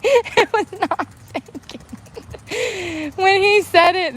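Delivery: high, slow, laughing plea. Real, not acted. Vlogger laughing while claiming innocence. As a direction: giggle out the excuse. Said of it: "genuinely innocent".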